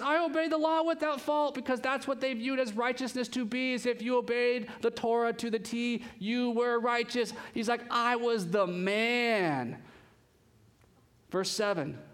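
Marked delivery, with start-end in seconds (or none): 0.0-1.5 high-pitched, emphatic brag; acted self-righteous boast. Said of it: "it's like these boasts". 7.9-9.8 long drawn-out swaggering brag; acted cocky self-praise; boasting. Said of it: "it's like these boasts".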